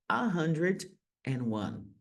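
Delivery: slow, careful "a hundred and one"; slow model. Shown as a demonstration. Teacher slowly models "a hundred and one" for the class. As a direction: lay it out slowly, one word at a time. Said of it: "Essa é a ideia".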